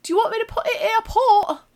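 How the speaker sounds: insistent high, loud Cumbrian clerk; pushy Northern English server impression; Cumbrian accent. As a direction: repeat it in thick mock Cumbrian. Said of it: "she just keeps repeating".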